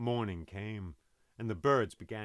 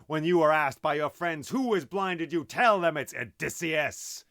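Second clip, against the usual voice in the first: louder, defiant shout across the water; boastful hero shouting his name to the blinded Cyclops; yelled taunt; 2 semitones higher, 6 dB louder than usual. Acted. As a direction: yell it back at the giant in triumph. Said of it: "I yelled back".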